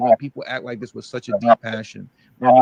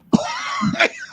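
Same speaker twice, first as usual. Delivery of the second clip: laugh. Real, not acted. Laughs at own impression.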